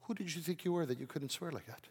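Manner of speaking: soft, quiet challenge; God questioning. Acted. God questions the preacher about his swearing, softly. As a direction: ask it gently, voice well down. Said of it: "And God says".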